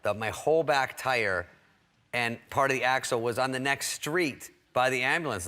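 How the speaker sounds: normal talking voice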